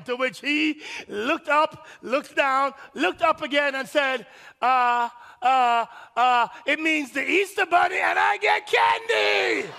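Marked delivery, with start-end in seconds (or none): 4.6-9.7 put-on high, childish voice, a touch shouty; a little boy blurting his answer; son's answer. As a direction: kid proud of his answer about candy. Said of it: "I'm glad you think it's funny".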